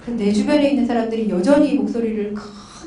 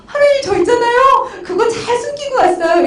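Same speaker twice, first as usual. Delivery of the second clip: high, laughing voice to God; half-laughing, squeaky-high parody of confessing; mock confiding boast; 11 semitones higher, 5 dB louder than usual. Believer reporting to God in heaven, high giggly mock pride.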